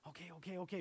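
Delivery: whispered-soft okays; hushed. Retold or imitated. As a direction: brother answering his sister's hush, hushed. Brothers answer in hushed voice.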